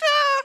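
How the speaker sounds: a sudden, very high, loud burst of "Ah!"; leaders' mock outrage, a sudden high, loud "Ah!"; mock outraged cry. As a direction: blow a fuse out loud. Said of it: "steam coming out of their ears".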